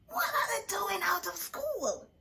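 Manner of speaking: scratchy whisper; hoarse disbelieving whisper. A narrator's reading. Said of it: "she said in a scratchy voice".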